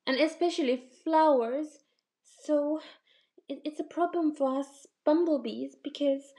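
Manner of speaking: small high bee voice, eager; cute bumblebee. Acted. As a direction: pipe up like a tiny helpful bee. Bumblebee character voice.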